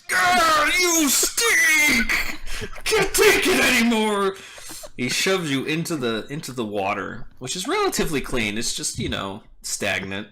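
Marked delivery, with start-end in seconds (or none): 0.0-4.4 high, loud roar drawn from a raging half-orc; furious acted yell. Raging half-orc yelling in Barry's face, loud high yell. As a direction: yell it right in their face. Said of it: "Guncork yells in your face".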